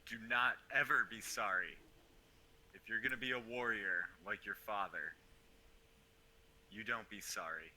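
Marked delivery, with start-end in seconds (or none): 0.1-4.6 louder, higher stern order; firm warrior command. Acted. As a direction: command him never to apologize. Warrior scolds the boy.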